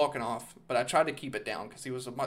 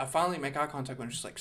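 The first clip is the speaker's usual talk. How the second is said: ordinary delivery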